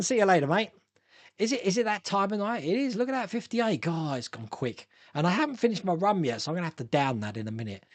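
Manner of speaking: ordinary talk, nothing marked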